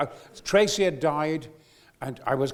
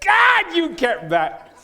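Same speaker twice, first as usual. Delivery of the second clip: surprised exclamation; 6 semitones higher, 8 dB louder, much slower than usual. Retold or imitated. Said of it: "I thought".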